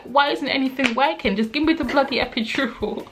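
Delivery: high-pitched exasperated demand; frustrated, annoyed. Retold or imitated. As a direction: retell a fed-up patient pleading for relief. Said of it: "frustrated and annoyed".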